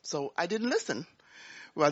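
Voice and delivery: normal talking voice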